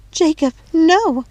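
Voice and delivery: high, loud, drawn-out cry; anguished cry. Acted. Woman seeing her beaten lover, anguished slow cry. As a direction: cry his name out in horror, slowly. Mania sees Jacob beaten.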